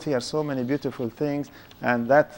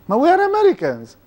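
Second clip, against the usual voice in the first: indignant protest; 10 semitones higher, 3 dB louder than usual. Retold or imitated. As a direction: protest it high, as if wrongly accused. Acted protest to hostile caller.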